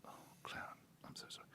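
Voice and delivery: soft whispered sympathy; shaken whisper. Retold or imitated. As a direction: whisper sorrow. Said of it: "and it's worse still".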